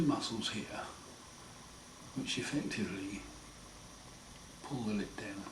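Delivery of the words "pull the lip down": ordinary talk, nothing marked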